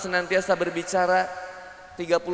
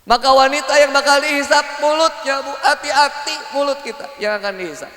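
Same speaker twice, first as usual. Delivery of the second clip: loud, high sermon warning; stern preaching; 7 semitones higher, 6 dB louder than usual. Acted. Preacher warning women about their tongues, forceful. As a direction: warn the hall forcefully.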